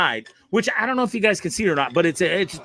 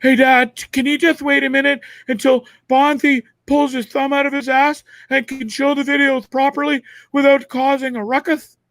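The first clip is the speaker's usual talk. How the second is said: mock kid voice; 8 semitones higher than usual. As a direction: ask it like a pestering little son. Mimicking kid asking his dad.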